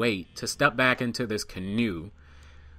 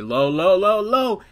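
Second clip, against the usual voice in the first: calls building to a loud, high yell; rising mock alarm shout; calling out urgently; 8 semitones higher, 7 dB louder, slower than usual. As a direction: warn him as the branch comes at you. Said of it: "It was like a sitcom".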